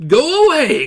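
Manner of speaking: high and loud wizard cry; booming cry. Acted. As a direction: cry it out from the pipes. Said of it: "cried the voice from the pipes".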